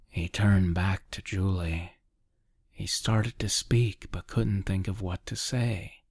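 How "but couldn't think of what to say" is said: ordinary delivery, nothing marked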